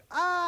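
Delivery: high scream that comes after the impact; short fall, late high yelp; belated falling scream. Retold or imitated. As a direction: hit first, then cry out high. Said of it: "falling out one story goes".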